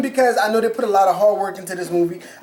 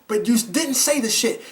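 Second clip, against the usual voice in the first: ordinary talk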